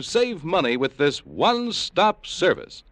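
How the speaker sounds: ordinary talk, nothing marked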